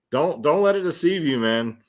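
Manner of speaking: ordinary talk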